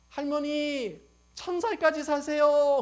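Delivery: high, loud, slow well-wishing call; calling to granny. Acted. Preacher wishing a grandmother long life, loud drawn-out call. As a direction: call out a blessing to an old woman, drawn out.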